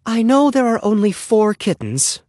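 high-pitched motherly complaint; exasperated mother. A narrator's reading. Flustered mother complaining of kittens.

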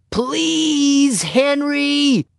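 put-on very high, stretched-out child's plea; begging. A narrator's reading. Ramona pleads with Henry after he refuses, begging. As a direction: beg like a small girl desperate to hear it. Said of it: "she begged".

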